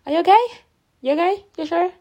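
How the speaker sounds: high, loud rising questions; imitating concerned coworkers. Coworkers checking on her, retold.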